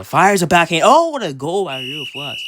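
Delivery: loud, high goal call; excited goal call. Acted. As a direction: shout the goal like an announcer.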